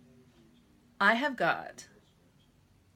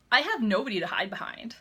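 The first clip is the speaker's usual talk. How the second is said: ordinary talk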